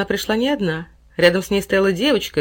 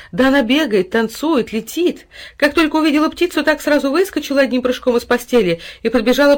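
high, loud, gushing account; breathlessly thrilled storytelling; excited report; 7 semitones higher, 5 dB louder than usual. A narrator's reading. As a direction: gush over a wonderful recovery. Neighbour excitedly reports recovery.

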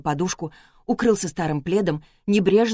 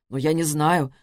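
ordinary delivery

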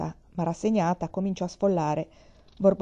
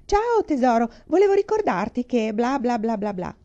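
high, louder cheery wife voice; shrill wife on phone; 5 semitones higher, 5 dB louder than usual. Acted. Wife calling the director on speakerphone, shrill chirp. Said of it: "strillò un sonoro".

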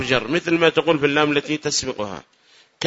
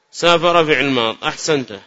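ordinary talk, nothing marked

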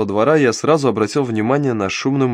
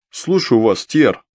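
hollow boom like inside a barrel; slower than usual. Acted. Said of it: "прогудел, как из бочки".

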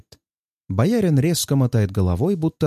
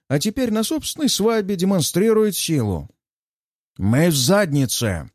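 enraged; 5 semitones higher than usual. Acted. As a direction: rage at a hopeless situation. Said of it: "Годунов озверел".

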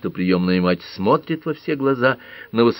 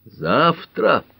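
a raised, drawn-out word; slow, deliberate; 9 semitones higher than usual. A narrator's reading. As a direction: say it slowly, weighing it. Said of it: "медленно проговорил". (медленно, с расстановкой)

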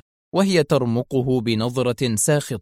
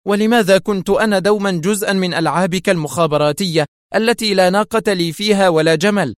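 raised, higher-pitched protest; indignant cry; 7 semitones higher, 4 dB louder than usual. A narrator's reading. Woman protests being used in the agent's spy games, crying out. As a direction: throw the accusation at him, loud and high. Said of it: "وهي تهتف به".

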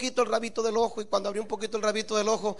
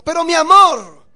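high, loud pleading cry; alarmed protest; 7 semitones higher, 14 dB louder than usual. Acted. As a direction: stop him in alarm, louder. Wife protests his extravagance.

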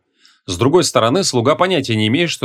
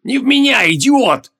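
high-pitched exasperated bellow; outraged, high shout; angry yell; 12 semitones higher, 3 dB louder than usual. A narrator's reading. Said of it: "заорал Креол".

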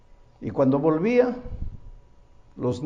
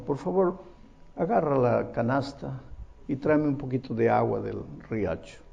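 put-on soft, lower old grandmother's request; gentle grandma; 5 semitones lower, 7 dB quieter than usual. Grandma asking grandson for water, soft. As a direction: ask kindly, quietly. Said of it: "la viejita le dice al nieto mayor".

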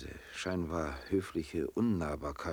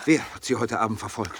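sharp, louder question; urgent demand; 4 semitones higher, 9 dB louder than usual. Acted. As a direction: press her for the name. He presses her urgently. (drängende, laute Frage)

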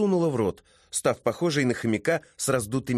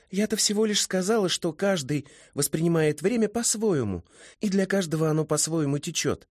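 put-on high, quick girlish voice; high-voiced, lively young woman protesting; young woman setting straight; 8 semitones higher than usual. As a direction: correct him airily, pitched well up.

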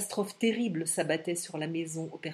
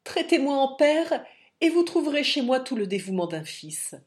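higher, louder heartfelt offer; eager plea; 5 semitones higher, 5 dB louder than usual. A narrator's reading. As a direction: beg to help him, voice raised. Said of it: "s'écria-t-il".

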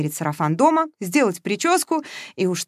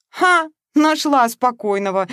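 scoffing burst; 6 semitones higher, 5 dB louder, slower than usual. Acted. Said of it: "не выдержала Маша".

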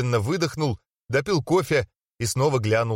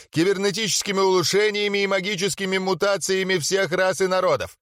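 higher, hyped ring announcer; rally speech; 6 semitones higher than usual. Acted. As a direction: pump up the arena crowd.

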